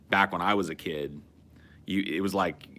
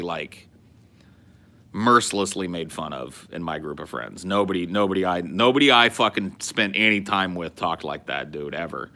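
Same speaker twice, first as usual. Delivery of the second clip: ordinary talk, nothing marked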